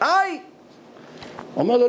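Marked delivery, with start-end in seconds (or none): 0.0-0.4 high-pitched "ow!"; imitated cry of pain, pitch leaping up; pained yell. Slapped Litvak crying out, acted pained yell. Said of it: "הליטאי צעק".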